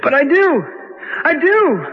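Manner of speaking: desperate call. Acted. Hears voices, they don't hear.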